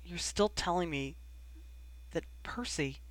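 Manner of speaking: soft, halting, trailing away; understated disbelief with a long, stunned pause; struggling to speak. A narrator's reading. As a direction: force it out haltingly, voice sinking. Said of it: "he finally managed".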